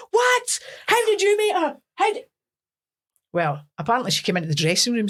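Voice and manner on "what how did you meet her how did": high-pitched outraged astonishment; incredulous mock yelp; shocked disbelief. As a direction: re-enact being stunned, pitch shooting up. Acting out her shocked reaction.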